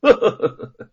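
loud, cruel laugh; vicious laugh. Acted. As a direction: sneer out a menacing laugh. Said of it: "恶狠狠地说".

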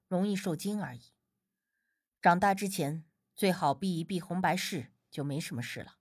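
put-on low, soft, unhurried young expert. A narrator's reading. Healer explaining to grandma why the child took fright, mildly reassuring. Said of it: "无忌淡笑道".

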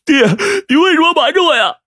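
high-pitched tearful wail; loud crying. A narrator's reading. Son confronting his startled parents, crying loudly. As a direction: cry out in anguished reproach. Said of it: "对着惊愕的爹娘大声哭道".

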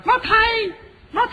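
high, loud bird squawk. Acted. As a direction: call it out like a greedy mynah. Bird demands liquor. (鸟叫般尖声喊)

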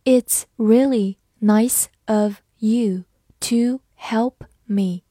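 slow model reading with clear gaps between words. Shown as a demonstration. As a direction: read slowly, word by word. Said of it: "慢慢来读". (慢速逐词朗读)

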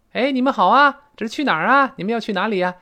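你们好啊 loud and high, calling out; cheery call. Shown as a demonstration. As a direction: greet them warmly from afar. Translating the greeting. (高声热情地招呼)

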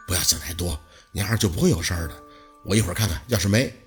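low, soft soothing voice; quiet reassurance. A narrator's reading. Lu Pei comforting worried Baosi, quiet reassurance.

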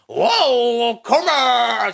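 long, high, loud drawn-out whoop; mock fan cheer, held high; crowd shouting. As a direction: imitate a rowdy crowd hollering. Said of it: "就开始喊上了".

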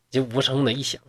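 usual speaking voice

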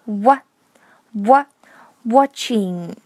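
"Wa-" from tight round lips, then "watching"; syllable-by-syllable demo of "watching"; rounded lips opening. Shown as a demonstration. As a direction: repeat "wa-" in isolation before the whole "watching". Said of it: "把嘴唇的肌肉慢慢的松开".

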